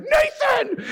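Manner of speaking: very high scolding cry; shrieked name in mock alarm; shocked teacher. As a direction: imitate a teacher calling out a misbehaving pupil. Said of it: "The teacher's like".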